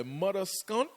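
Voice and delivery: high, loud mock Bajan cussing; hammed-up angry Bajan outburst, breaking into laughter; mock Bajan anger. Guest imitates Bajans getting mad, hammed-up angry outburst. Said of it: "When Bajans get mad".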